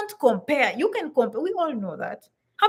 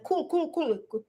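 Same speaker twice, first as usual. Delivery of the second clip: mock American cool; 3 semitones higher, 6 dB quieter than usual. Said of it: "like you're sounding American".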